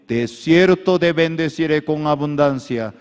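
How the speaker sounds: high, loud building proclamation; climactic preaching. Acted. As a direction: build to a fervent peak. (clímax de predicación)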